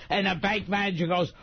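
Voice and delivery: normal talking voice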